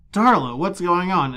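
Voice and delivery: put-on Angel calling out in a plain American accent. Angel calls to Darla from the background in an American accent. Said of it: "in a perfectly American accent".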